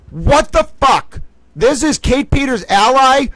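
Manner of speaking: high, loud furious disbelief; outraged outburst. Real, not acted. To the viewers about the ally, real furious outburst. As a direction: blow up in real outrage at the post. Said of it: "I know I'm getting frustrated".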